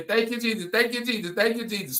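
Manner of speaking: high-pitched mock church shout of praise; lightly hammed-up holy-church praise cry; church shouter. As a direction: imitate a churchgoer hollering praise in your ear. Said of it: "yelling in your".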